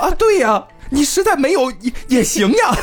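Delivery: high mock voice. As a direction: squeak it out teasingly. Mock officials' reply.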